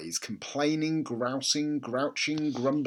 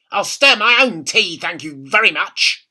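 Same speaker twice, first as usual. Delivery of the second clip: put-on higher, louder bossy old woman; bossy grandma; 6 semitones higher, 12 dB louder than usual. A narrator's reading. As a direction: order the boy about. Grandma voiced in read story.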